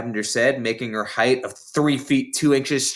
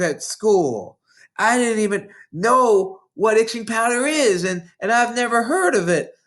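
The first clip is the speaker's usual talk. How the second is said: retold protest shout; 11 semitones higher than usual. Girl protesting innocence to the headmistress, in a high mock shout. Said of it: "I kept shouting".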